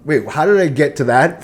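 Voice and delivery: ordinary talk, nothing marked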